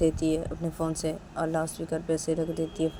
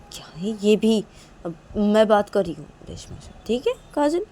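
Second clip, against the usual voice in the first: mildly exasperated, with rising questioning hooks; a mother lightly fuming on her daughter's behalf; indignant mother; 5 semitones higher, 8 dB louder than usual. Acted. (हल्की झुँझलाई माँ की आवाज़)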